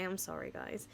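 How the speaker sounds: sheepish whispered apology; whispering. Real, not acted. Embarrassed apology after a slip.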